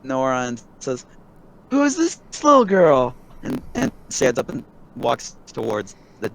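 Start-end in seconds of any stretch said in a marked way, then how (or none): 1.7-3.2 raised pitch, friendly stranger; high NPC voice. Acted. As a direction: voice the curious man up high. GM voicing an NPC.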